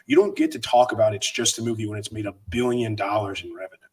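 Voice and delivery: ordinary talk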